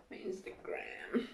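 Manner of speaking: hushed whisper hunting for the phone. Real, not acted. Searching for her phone, whispering.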